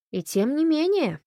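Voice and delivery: высоко и бодро; весело возразить. A narrator's reading. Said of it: "весело возразила Фанни". (весёлое, высокое возражение)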